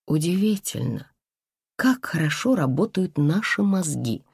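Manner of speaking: ordinary delivery, nothing marked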